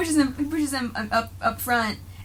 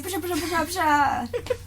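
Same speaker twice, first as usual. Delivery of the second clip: mock gunfire noises; 6 semitones higher than usual. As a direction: mouth the guns firing in a quick run. Imitating gunfire sounds.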